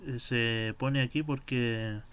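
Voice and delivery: usual speaking voice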